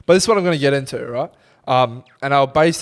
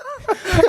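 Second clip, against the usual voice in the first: short high burst of laughter. Real, not acted. Laughing along with friend's story, short high laugh. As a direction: laugh it off quickly.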